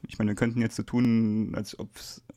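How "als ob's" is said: conspiratorial whisper. Acted. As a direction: whisper it as a secret aside. Joking aside about pretending.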